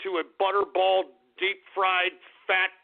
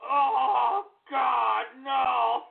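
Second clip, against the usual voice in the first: mock groans; 3 semitones higher, much slower than usual.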